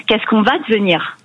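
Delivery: usual speaking voice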